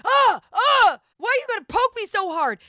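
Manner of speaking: high, loud mock yelps into a complaint; startled yelping. Wife mimicking husband poked awake, startled high-pitched protest. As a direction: imitate someone jolted awake and whining.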